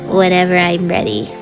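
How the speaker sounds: normal talking voice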